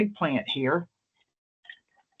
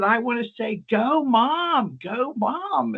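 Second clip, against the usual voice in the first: much higher mock cheer; [name] cheering; 8 semitones higher, slower than usual. [name] joking about cheering her mom on, high mock cheer. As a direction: chant it like a sideline fan. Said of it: "And she said".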